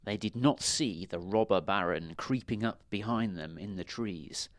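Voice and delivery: ordinary delivery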